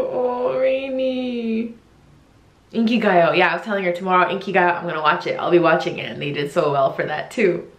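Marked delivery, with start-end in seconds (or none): none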